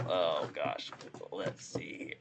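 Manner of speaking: usual speaking voice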